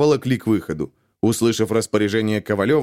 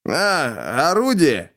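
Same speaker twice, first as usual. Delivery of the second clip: drawn out; 4 semitones higher, much slower than usual. A narrator's reading. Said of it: "протянул подозреваемый".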